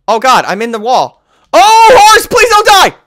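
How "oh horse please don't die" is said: high loud panicked begging; panicked plea. Real, not acted. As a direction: beg in a panic.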